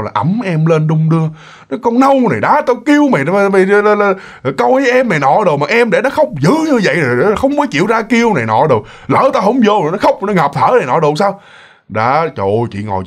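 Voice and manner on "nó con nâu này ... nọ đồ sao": higher, sharp scolding of a careless daughter; a mother telling off her daughter, raised and sharp; mock scolding mother. Mother scolds the older sister for letting the baby cry, mock angry.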